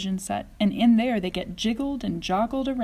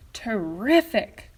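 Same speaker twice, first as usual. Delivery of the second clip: slow, drawn-out excited cry; delighted cry; 4 dB louder, slower than usual. A narrator's reading. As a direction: savour the wonder. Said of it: "cried Grandpa Joe".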